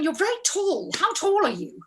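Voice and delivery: high-pitched mock accusation; accusing. Costumier sizing up an actor, high and accusing. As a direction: imitate someone affronted by what they see.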